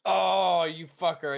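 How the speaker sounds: loud startled curse; loud outburst. Real, not acted. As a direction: bark it out in surprise. Reacts to opponent's move.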